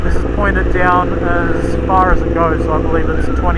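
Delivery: muffled voice inside a motorcycle helmet; talking into a helmet mic while riding. Real, not acted. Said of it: "I've got the visor closed on the Ruroc Atlas".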